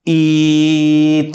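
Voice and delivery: "ई" held long on a level pitch; sustained vowel. Shown as a demonstration. Teacher proving a vowel stays unchanged, "ई" held long. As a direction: stretch "ई" out without changing it. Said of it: "आप कितना भी लंबा खींचे".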